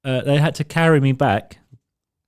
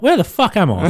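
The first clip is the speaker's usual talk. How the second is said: louder, higher bewildered cry; confused outburst; 6 semitones higher, 5 dB louder than usual. Retold or imitated. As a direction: wake up lost and blurt it out. Waking up lost on stage.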